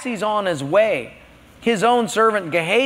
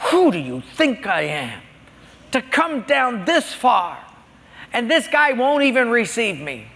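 mock rage; 6 semitones higher than usual. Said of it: "He's in a rage".

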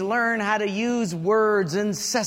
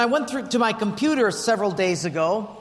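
ordinary talk

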